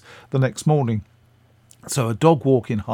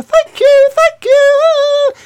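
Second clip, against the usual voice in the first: much higher, louder put-on voice of an elderly actress, thanking; Patricia Hayes calling out; 10 dB louder, much slower than usual. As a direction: call it up high and loud out the window. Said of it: "she calls out the window".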